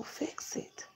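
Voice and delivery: a private whispered plea to God; whispered prayer. Retold or imitated. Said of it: "I just said".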